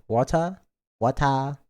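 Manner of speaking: "water" with a crisp t and no final r; fluctuating pronunciation. Shown as a demonstration. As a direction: say "water" as wah-tah. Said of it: "the way I say water".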